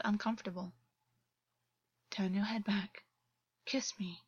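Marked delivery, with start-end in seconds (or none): 2.1-4.2 whispered, close and soft. A narrator's reading. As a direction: breathe the words without voice. Intimate command in bed.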